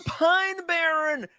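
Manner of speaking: high, slow, sing-song name call; hammed-up name call. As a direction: stretch the name out grandly. Reading super chat name out.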